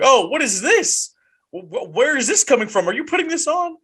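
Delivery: mock bafflement at where the voice comes from; puzzled listener. Fan hearing the accent drop, imitating puzzled surprise. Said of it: "You're like".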